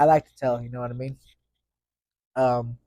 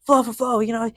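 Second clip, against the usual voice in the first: much higher and louder, a hype rapper quote; 10 semitones higher, 5 dB louder than usual. Retold or imitated. Quoting [name] to viewers, hyped. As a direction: toss out the line like a rapper. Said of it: "how, like, [name] said".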